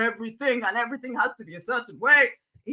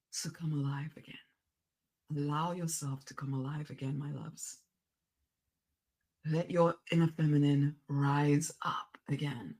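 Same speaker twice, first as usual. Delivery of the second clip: soft, low unvoiced whisper; 9 semitones lower, 5 dB quieter than usual. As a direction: whisper it close and tender. Whispered encouragement to listeners.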